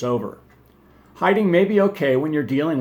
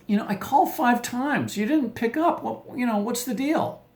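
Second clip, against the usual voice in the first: annoyed wife; 9 semitones higher than usual. Retold or imitated. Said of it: "She goes".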